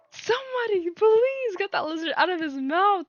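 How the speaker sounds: high, loud panicked plea, acted out; scared. Owner begging someone to take the lizard, mock terror. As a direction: imitate yourself squealing for help. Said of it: "I'm scared".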